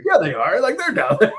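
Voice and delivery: laughing high reply. Retold or imitated. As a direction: giggle through the answer.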